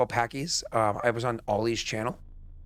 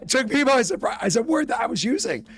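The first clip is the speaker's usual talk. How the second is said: excited; 12 semitones higher, 6 dB louder than usual. Real, not acted. Excited retelling of surprise.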